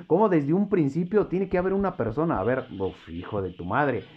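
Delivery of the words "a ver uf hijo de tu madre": normal talking voice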